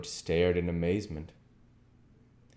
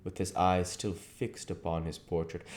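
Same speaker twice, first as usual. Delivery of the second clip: usual speaking voice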